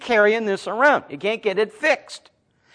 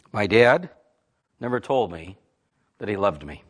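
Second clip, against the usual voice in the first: imitating tearful confession; 10 semitones lower than usual. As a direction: retell a man close to tears, pausing between phrases. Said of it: "Then he starts crying".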